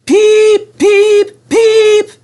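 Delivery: very high, loud whistle blasts; shrill whistle peeps; guard's whistle. A narrator's reading. The guard whistles a warning from behind, very high and loud.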